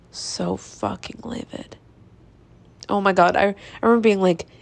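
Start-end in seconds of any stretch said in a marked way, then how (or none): 0.1-1.8 slow, breathy whisper of anger; whispered fury. Real, not acted. Whispering to listeners how livid the tea request made her. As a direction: let the rage out as a hushed whisper.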